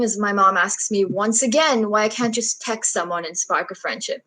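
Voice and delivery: usual speaking voice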